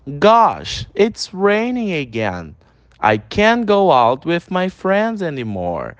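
slow, carefully spelled-out English; slow teacher's pronunciation demo; slow pronunciation model. Shown as a demonstration. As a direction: model each word slowly for students to repeat. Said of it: "Vamos lá, mais uma vez".

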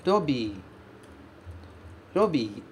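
"robi." with a tapped R and a long i; Japanese robii. Shown as a demonstration. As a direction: model "robi." with the flap and a long bi. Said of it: "esse bi é prolongado". ("robi" com R batido e i longo)

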